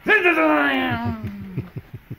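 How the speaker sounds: high, loud power saw whine; a second revving chainsaw sound; chainsaw impression. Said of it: "That was my chainsaw impression".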